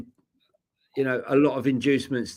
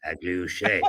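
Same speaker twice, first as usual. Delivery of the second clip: Agliouche in a lower, mock French accent; 8 semitones lower, 4 dB quieter than usual. Copying his co-host's French name, in a mock French accent. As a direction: imitate the French way of saying Agliouche. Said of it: "Can you say that in French accent?".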